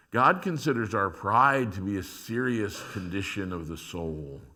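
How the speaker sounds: ordinary delivery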